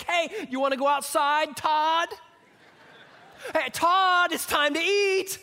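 a kindergartner yelling her dad's first name; little girl calling Todd. Retold or imitated. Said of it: "She would say things like".

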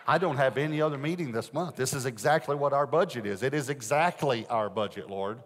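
loud, laughing plea to the Lord; preacher arguing with God about the budget, laughing loudly; laughing protest. Retold or imitated.

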